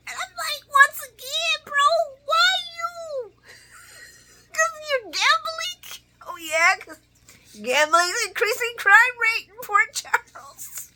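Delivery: very high, squealing through laughter; laughing to tears. Real, not acted. Mocking Curtis's gambling excuse, laughing till nearly crying. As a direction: drag the words out in a helpless high squeal. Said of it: "I'm trying not to cry".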